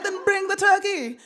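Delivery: high bossy mom line; voicing mom. Mom ordering her son to bring the turkey, high mock mom.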